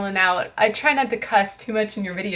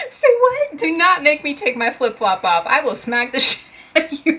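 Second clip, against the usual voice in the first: high, hammed-up indignant threat; mock threat; 6 semitones higher, 4 dB louder than usual. Reader acting out a flip-flop showdown, mock angry. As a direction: threaten them playfully, pitch raised.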